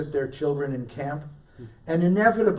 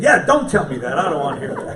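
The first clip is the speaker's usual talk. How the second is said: louder, laughing objection; laughing protest; 3 semitones higher, 10 dB louder than usual. Retold or imitated. Telling the boy not to say it, laughing loudly. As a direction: laugh while you protest.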